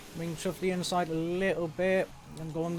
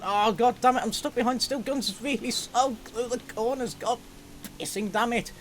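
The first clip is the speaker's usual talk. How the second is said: frustrated outburst; 9 semitones higher than usual. Real, not acted. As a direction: blow up at being stuck. Stuck behind a slow car.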